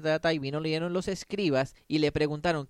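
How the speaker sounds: ordinary talk, nothing marked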